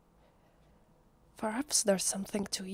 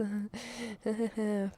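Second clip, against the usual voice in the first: sheepish laugh; 2 semitones higher than usual. Acted. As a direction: laugh it off apologetically. Sheepish laugh while apologising.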